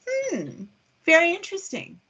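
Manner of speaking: politely intrigued English understatement, pitched high; polite English person. Shown as a demonstration. English person giving humorous exaggerated example of veiled disapproval, politely noncommittal. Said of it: "the English person would say".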